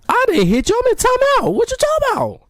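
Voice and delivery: very high, indignant protest; outraged denial. Retold or imitated. Kid in timeout protesting to teachers, outraged.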